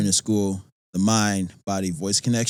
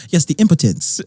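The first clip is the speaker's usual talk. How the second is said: pompous student; 7 semitones higher, 8 dB louder than usual. Retold or imitated. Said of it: "I used to speak like this".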